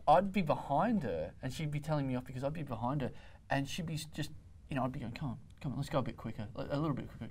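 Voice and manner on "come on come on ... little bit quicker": soft, low gentle urging; near-whispered coaxing. Retold or imitated. Runner urging girlfriend from behind, near-whispered coaxing. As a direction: coax quietly, as if in her ear. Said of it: "You're whispering in her ear".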